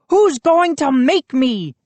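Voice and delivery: high taunting challenge; demanding. A narrator's reading. Cocky high dare. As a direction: Moose taunts Joe from the table, taunting. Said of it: "he demanded".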